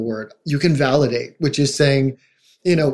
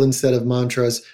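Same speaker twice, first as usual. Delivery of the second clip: ordinary talk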